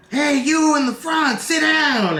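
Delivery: high mock yell at the crowd; imitating a rowdy drunk fan.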